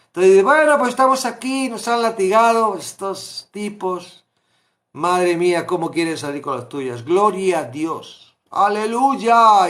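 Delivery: loud, high, exultant cry to God; jubilant praise. Acted. Paul in jail praising God, loud and exultant. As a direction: shout your praise from the prison cell.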